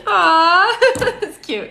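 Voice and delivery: high, laughing aww; gushing through a laugh. Real, not acted. Touched by sweet question.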